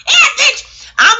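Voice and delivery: loud laughing yeah; excited shout. Real, not acted. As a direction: burst out laughing at yourself.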